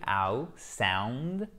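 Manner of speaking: "ow" drawn out alone, then sound with that same glide; isolated diphthong. Shown as a demonstration. Teacher isolating the diphthong, ow then sound with a clear glide. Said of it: "avec la diphtongue".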